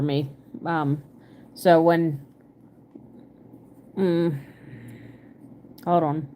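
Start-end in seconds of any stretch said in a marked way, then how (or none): 4.0-6.3 talking around a needle held in the mouth; muffled words with something between the lips; mouth full. Real, not acted. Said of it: "I try not to talk when I have a needle in my mouth".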